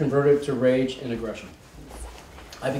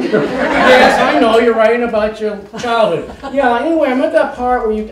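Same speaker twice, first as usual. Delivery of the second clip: excited, laughing; 8 semitones higher, 6 dB louder than usual. Retold or imitated. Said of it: "mother dialogue retold".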